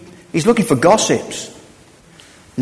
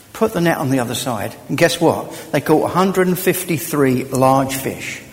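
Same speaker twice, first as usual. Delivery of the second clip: normal talking voice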